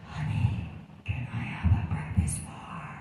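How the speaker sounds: hushed unvoiced question; whisper. Man whispering to his wife about her outburst. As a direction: lean in and breathe it to your wife.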